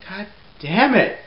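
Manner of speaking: a loud, slow, hammed-up curse; playful exasperated shout; mock frustrated outburst. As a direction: vent like a sister who lost the TV. Annoyed that sisters won't share TV.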